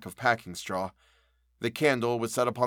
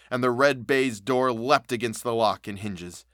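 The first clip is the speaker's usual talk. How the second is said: usual speaking voice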